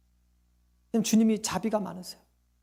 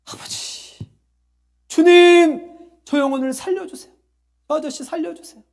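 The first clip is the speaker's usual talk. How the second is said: high, loud, desperate cry to God; 소리 지르는 기도; 7 semitones higher, 7 dB louder than usual. Retold or imitated. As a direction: cry out for a dying man's life. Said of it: "제가 소리를 질렀을까요?". (소리 질러 살려달라는 절박한 기도)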